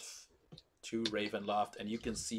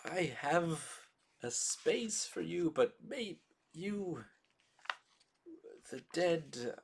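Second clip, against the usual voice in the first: frightened priest; 7 semitones higher than usual. Acted. Donovich pleads, pitch rising.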